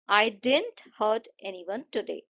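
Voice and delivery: "didn't" stressed, the sentence said slowly. Shown as a demonstration. Teacher showing how focus changes meaning, stress on "didn't". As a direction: lean on the word "didn't", taking it slowly. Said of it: "जब आपने didn't पे focus करा".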